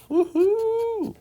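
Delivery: high, gleeful woohoo; cheerful woohoo. Real, not acted. Cheering another bevel.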